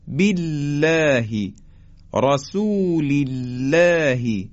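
بالله and رسول الله with thin lam, fronted long "læ"; very slow recitation, keep both بالله and رسول الله light; light tarqiq. Shown as a demonstration.

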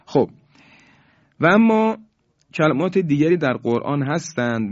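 normal talking voice

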